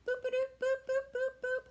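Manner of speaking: put-on high playful boop-a-doop, sung out to the kids; bouncy nonsense boops to call a puppet; silly boop sounds. Teacher to children, high playful boops.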